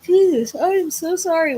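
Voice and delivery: words pulled long and high through a big yawn. Real, not acted. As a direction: talk right through a real yawn. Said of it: "why I'm yawning so much".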